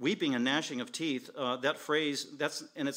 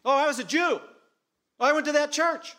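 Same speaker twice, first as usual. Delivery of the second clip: high, loud desperate pleading; pleading unbeliever; 11 semitones higher, 8 dB louder than usual. Acted. Preacher voicing pleading unbelievers at judgment. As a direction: beg the judge to remember you.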